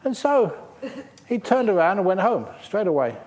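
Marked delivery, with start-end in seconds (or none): none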